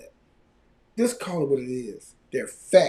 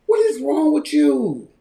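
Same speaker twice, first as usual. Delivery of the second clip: high, loud, drawn-out disbelief; exasperated outburst; 10 semitones higher, 7 dB louder, slower than usual. Real, not acted. Host scolds men who admire fat women, exasperated. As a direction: cry out in slow, scandalized exasperation.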